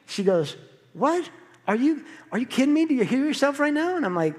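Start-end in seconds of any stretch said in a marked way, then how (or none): 0.9-4.0 high-pitched imitation of a disbelieving wife; raised pitch, rapid disbelieving questions; incredulous wife. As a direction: challenge him as his baffled wife. Voices his wife's indignant objection.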